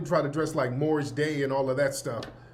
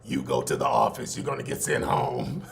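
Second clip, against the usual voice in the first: electrolarynx coach; 3 dB quieter than usual. Retold or imitated. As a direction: coach barking orders through a throat voice box, very low and buzzy. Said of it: "he talked like this".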